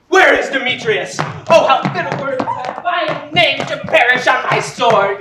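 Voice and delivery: loud furious demand; shouting in a jealous fury; angry outburst. Acted. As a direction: rage at the rival's name. Lysander raging over Demetrius.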